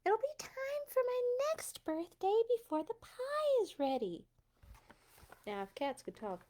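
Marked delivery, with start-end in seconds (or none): 0.0-4.3 high squeaky cat whining; pouty little cat, pitched way up. Acted. Cat character keeps voice.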